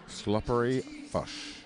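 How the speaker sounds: slow, hammed-up New Zealand vowels on "Sloppery fish"; mock New Zealand accent. Commentator teasing a player's nickname in a mock New Zealand accent. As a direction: drag out "Sloppery fish" in a jokey Kiwi accent. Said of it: "a shit accent of ours".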